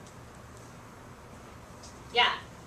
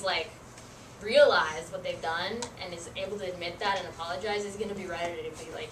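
normal talking voice

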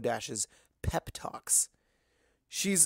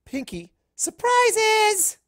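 very high, loud, drawn-out squeal; overjoyed pony squealing; delighted shriek; 10 dB louder, much slower than usual. Acted. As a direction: stretch surprises into a thrilled squeal.

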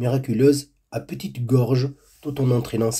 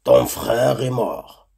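slow vulture voice, deliberate and cold; a bird of ill omen dragging out the news; slower than usual. A narrator's reading. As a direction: vulture tells the girl her brother is dead, slowly. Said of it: "le vautour lui annonça".

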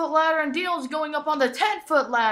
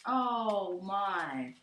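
normal talking voice

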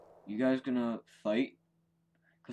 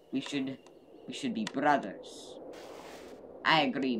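usual speaking voice

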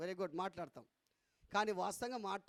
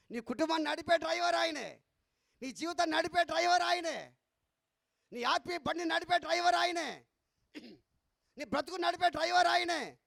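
rising, louder preaching refrain; preaching at full force; 6 semitones higher, 5 dB louder than usual. Acted. Preacher driving the point to the congregation, full-force preaching. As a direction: hammer each line home to the congregation.

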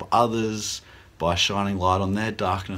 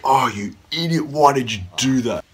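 mock self-scolding; 4 semitones higher, 4 dB louder than usual.

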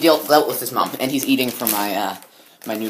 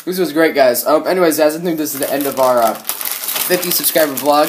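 usual speaking voice

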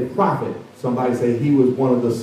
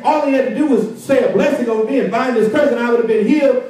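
much higher, indignant outrage; indignant general; 10 semitones higher than usual. Acted. General to his servants, outraged at the prophet, indignant rant. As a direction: hurl the insult back, offended pride. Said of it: "storming mad".

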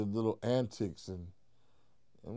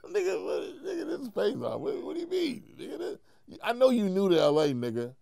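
incredulous outburst; 10 semitones higher than usual. Retold or imitated. Incredulous at rival's claim.

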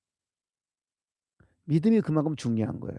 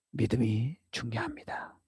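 whispered; 8 dB quieter than usual. Real, not acted. Sermon emphasis.

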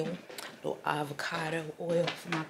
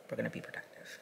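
soft whispered repeat of "We're gonna be productive"; whispered aside; 5 semitones lower, 9 dB quieter, faster than usual. Real, not acted. Telling viewers the day's plan, whispering it to herself.

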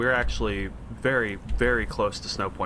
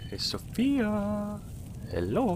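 drawn-out call; 13 semitones higher, 4 dB quieter, much slower than usual. Real, not acted. Calling out to companion.

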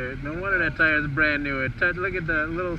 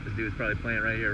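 ordinary delivery

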